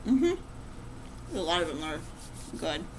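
chewing between words; talking while chewing. Real, not acted. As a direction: mouth partly full. Seen 0: left speaker ate snack pieces earlier, mouth shows chewing while talking.

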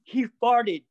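hammed-up indignant stylist; mock outraged guy. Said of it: "He's like".

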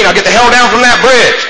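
high, loud, half-laughing command; firm order. Retold or imitated. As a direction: tell him straight, no nonsense. Sponsor orders man off bridge.

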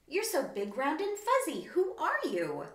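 a wide-eyed youngster gushing; eager. Acted. Wendy eagerly asks the big wombat who he is. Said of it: "and eagerly asked".

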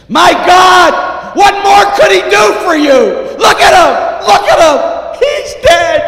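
anguished outcry. Acted. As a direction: scream it at them in outrage. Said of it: "she cries out".

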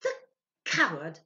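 sharp, loud cry of scorn; a loud, scornful cry of coward; indignant outburst. A narrator's reading. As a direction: spit out the insult. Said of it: "trembling violently".

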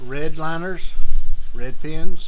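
loud, projected over the wind; shouting over wind. Real, not acted. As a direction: raise your voice against the gusts. I hope you can hear me over the wind.

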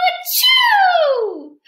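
high, loud acted sneeze; loud, high sneeze from a clogged nose; big sneeze. I'm all clogged up.

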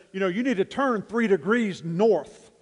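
loud, high call through a megaphone; admiral shouting. Retold or imitated. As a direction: bark the order across the water. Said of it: "he shouts out".

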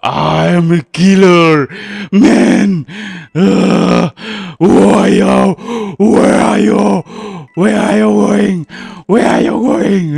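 loud, slow, taunting killer; menacing killer voice. Pretend. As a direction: stalk them with the words. (suara pembunuh mengejek)

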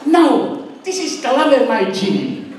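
high-pitched mock comeback; mildly indignant, high retort; snapped-back retort. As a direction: imitate a niece snapping back, pitched well up. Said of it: "she snapped back".

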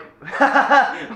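high, sudden laugh of disbelief; burst of laughter. Real, not acted. Hearing Cree's wild claim, a high burst of laughter. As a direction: laugh out loud in shock.

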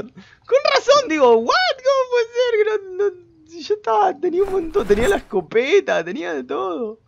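very high, squeaky laughing voice; laughing disbelief. Real, not acted. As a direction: protest while cracking up. Still cracking up over blunder.

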